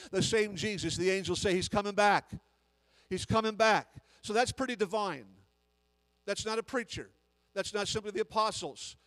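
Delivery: loud fervent preaching; full-voiced pulpit preaching; preaching at full force. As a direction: build it like a revival sermon.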